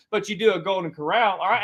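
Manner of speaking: usual speaking voice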